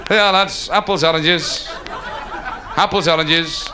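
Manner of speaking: drawn-out hawker's call; street vendor's cry. Acted. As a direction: call your wares through a crowd. Tray seller again.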